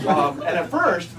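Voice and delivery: normal talking voice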